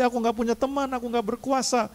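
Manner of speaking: high, pleading excuse-maker to God; whiny excuse. Retold or imitated. Believer making excuses to God, high whiny plea. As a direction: act out the lame excuse, pitched high. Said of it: "Jangan berdalih".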